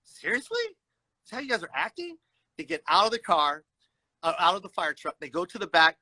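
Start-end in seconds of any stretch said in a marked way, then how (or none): 0.0-2.2 incredulous disbelief. Retold or imitated. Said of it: "I'm not gonna get mad".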